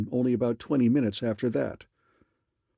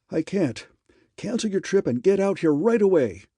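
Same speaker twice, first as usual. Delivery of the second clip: high, loud urgent rush of words; pleading with urgency, voice climbing; excited; 8 semitones higher, 5 dB louder than usual. A narrator's reading. Raymond on the phone begging Harker to cancel, high excited urgency. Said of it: "never heard Raymond this excited".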